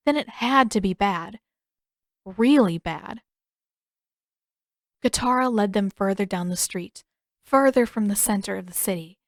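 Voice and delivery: ordinary delivery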